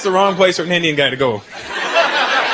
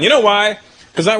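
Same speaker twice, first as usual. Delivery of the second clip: ordinary talk, nothing marked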